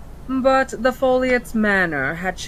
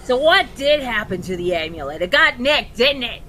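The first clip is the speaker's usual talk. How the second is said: cockney imp voice; 5 semitones higher, 4 dB louder, faster than usual. Acted. Imp speaks in role.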